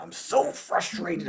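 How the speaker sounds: strained mock whine, held back in volume; mock frustration. Owner retelling being stuck with gear, mock-frustrated. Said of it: "where I'm, like, so frustrated".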